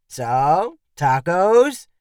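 teasing invitation with a rising end; playful. A narrator's reading. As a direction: coax her playfully, leaving a little gap before the offer. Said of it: "with a playful smile".

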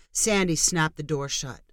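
ordinary delivery

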